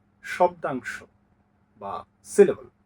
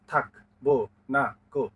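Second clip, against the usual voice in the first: "থাক, বো, না, কো" in four separate, even beats; syllable by syllable. Shown as a demonstration. Teacher tapping out verse syllables, "থাক, বো, না, কো" beat by beat. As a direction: tap out "থাক, বো, না, কো" with a gap after each syllable. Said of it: "আলতো করে চড় দেন".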